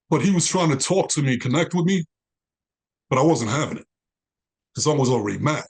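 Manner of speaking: normal talking voice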